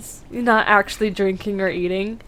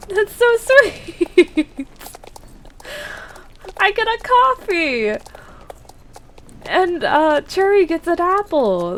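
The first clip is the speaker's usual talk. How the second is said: delighted; 8 semitones higher than usual. Real, not acted. Streamer finding she gets a coffee, delighted high squeal. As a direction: squeal with real delight at the treat.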